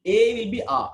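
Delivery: the letter A said the French way, like "ah". Shown as a demonstration. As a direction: give the French A a clear open sound. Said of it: "Okay, see".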